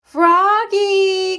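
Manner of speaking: called high across the yard; a mother calling her child from the door; long high call. A narrator's reading. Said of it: "called his mother".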